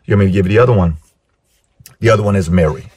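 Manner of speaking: normal talking voice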